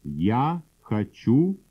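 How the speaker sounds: usual speaking voice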